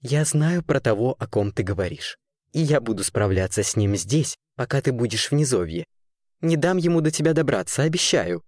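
low-pitched, reassuring man's voice; soothing weaver. A narrator's reading. Weaver promising to protect a girl, soothing and low. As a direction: reassure the child in deep, steady tones. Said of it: "успокаивал девочку ткач".

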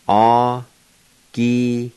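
very slow "Agiliye" with long held syllables; drawn-out teaching breakdown of "Agiliye"; syllable-by-syllable pronunciation. Shown as a demonstration. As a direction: stretch "Agiliye" out, pausing between syllables. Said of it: "pronounce these words".

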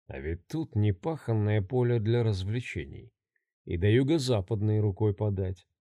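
low, soft sinister musing. Acted. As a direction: think it over quietly and coldly. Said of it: "размышлял он".

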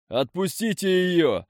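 high, slow shouting in rage; desperate angry yell. A narrator's reading. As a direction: yell after them in fury. Chained hero yells after captors.

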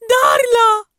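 high, very loud cry of alarm; screamed name. A narrator's reading. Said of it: "فصرخ سكان الحوض".

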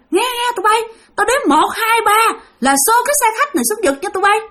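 high, commanding ghost counting down; ghost leader voice. Retold or imitated. Ghost leader ordering the gang to shove the bus, high.

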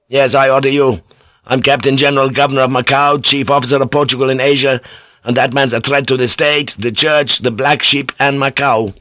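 high, forceful rapid-fire assertion; angry pulling rank. Acted. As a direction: reel off authority without yielding. Officer pulls rank in quarrel.

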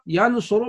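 ينصر pronounced "yansuru"; damma on the middle letter. Shown as a demonstration. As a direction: give ينصر a u vowel, yan-su-ru. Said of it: "بضم العين". (نطق ينصر بضم العين: يَنْصُرُ)

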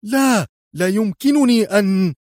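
high-pitched, desperate refusal; frantic cry. A narrator's reading. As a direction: cry out in refusal, cut off mid-sentence. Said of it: "هز جياروف رأسه في عنف صائحا". (صيحة رفض يائسة)

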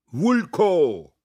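loud, high jeering call; a heckler cries out; shouted heckle. A narrator's reading. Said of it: "Der Ruf kam von der Bühne".

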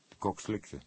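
usual speaking voice

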